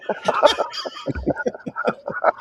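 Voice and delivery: breathless squeaky laugh; laughing too hard to speak; silent wheezing laugh. Real, not acted. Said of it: "She's laughing so hard she can't talk".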